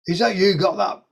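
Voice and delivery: lightly put-on broad Welsh accent; a caller hinting at a Welsh accent. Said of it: "on a really broad Welsh accent".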